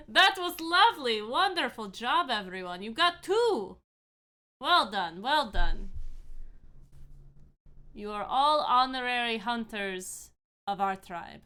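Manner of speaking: loud, hearty praise from a burly hunter; burly hunter chief. Acted. Burly hunter congratulating the group after the hunt, loud and approving. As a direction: call out praise with big swinging pitch. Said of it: "in this moment as he walks forward".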